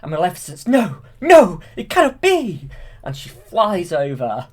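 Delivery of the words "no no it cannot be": high, loud villain shriek; Maleficent shriek. Retold or imitated. Villainess screams at the prince that it cannot be. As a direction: shriek it like the thwarted witch.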